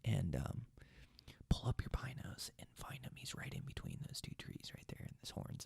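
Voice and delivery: barely audible, voiceless whisper. Retold or imitated. Hunter whispering directions to his cameraman, very hushed. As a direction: whisper it secretly so the buck won't hear. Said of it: "I should have whispered to Dylan".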